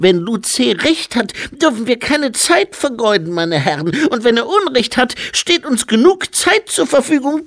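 very high, loud infant voice; squeaky toddler speechifying loudly; baby-like abbot. A narrator's reading. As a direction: play a dignified abbot in a baby's piping voice.

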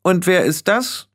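high, slow, imperious demand; haughty young witch; snapping. Acted. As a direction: question a newcomer bossily, drawn out and high. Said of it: "schnappte Anagramma".

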